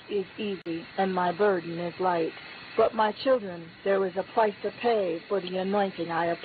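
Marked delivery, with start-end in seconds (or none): none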